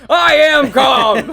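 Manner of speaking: calm turning angry. Real, not acted. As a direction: yell that you're calm. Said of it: "Calm down".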